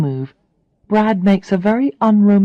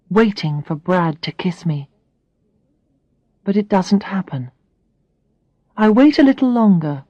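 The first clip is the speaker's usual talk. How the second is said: ordinary delivery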